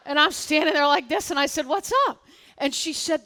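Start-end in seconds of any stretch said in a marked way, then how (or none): 1.7-2.2 high and loud, bright with eagerness; eager what's up. Retold or imitated. Molly to the manager, eager and high-pitched. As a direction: jump in keen to hear the news.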